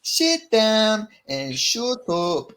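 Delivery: put-on high, very slow Irish accent; Ian Gary. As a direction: draw each word out in Irish. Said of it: "Can you look an Ian Gary or something".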